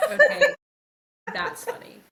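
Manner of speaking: high, loud laughing fit; real helpless laughter; laughing hard. Real, not acted. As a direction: crack up completely. Bursting laughter after joke.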